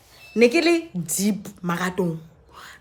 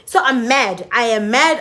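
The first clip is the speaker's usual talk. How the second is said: usual speaking voice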